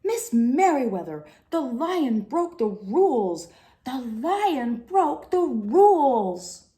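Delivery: loud, high, slowing urgent call. Acted. Librarian hurrying down the hall, loudly reporting the lion. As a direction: drag out the alarm as you hurry. Calling out the lion broke rules.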